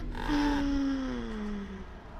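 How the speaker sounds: long acted gasp; drawn-out intake of breath under strain. Reader as tied-up girl struggling to move, strained gasp.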